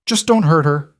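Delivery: high, strained desperate plea; voice breaking. A narrator's reading. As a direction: beg for her with the voice cracking. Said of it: "his voice breaking".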